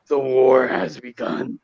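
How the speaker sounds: low, ominous villain voice; Darkseid impression. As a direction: intone it like a doom-laden overlord. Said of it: "that was my dog side impression".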